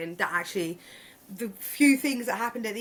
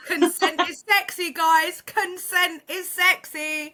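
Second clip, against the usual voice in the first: high, loud playful declaration; excited; 8 semitones higher, 6 dB louder, slower than usual. Real, not acted. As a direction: proclaim it with glee. Proclaiming to listeners.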